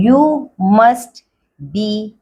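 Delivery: ordinary delivery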